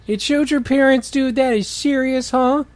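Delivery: lightly hammed-up thrilled [name]; excited friend. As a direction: burst out to your friend with excitement.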